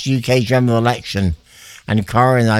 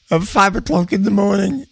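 higher-pitched, breaking into a laugh; 6 semitones higher than usual. Real, not acted. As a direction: say it through real laughter. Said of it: "I shouldn't laugh".

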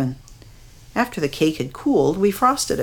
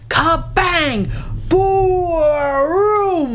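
growling thunder; 12 semitones higher, much slower than usual. A narrator's reading. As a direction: roar it like thunder. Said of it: "the thunder growled".

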